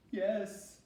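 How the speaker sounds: high tearful yes; sniffling. Acted. Billy Monster answering his father, high and tearful. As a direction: sniff it out, upset. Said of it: "sniffed Billy Monster".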